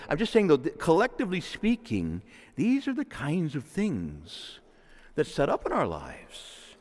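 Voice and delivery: normal talking voice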